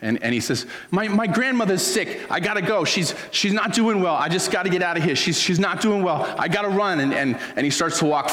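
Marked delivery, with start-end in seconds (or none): 0.9-7.0 high-pitched, agitated dodging debtor; acted panicky excuses. Debtor making excuses to escape his lender, high and agitated. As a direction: plead your way out, pitched high.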